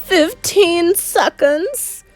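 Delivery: gleeful witch counting down; witch crying out. A narrator's reading. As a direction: relish each second. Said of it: "cried the Grand High Witch".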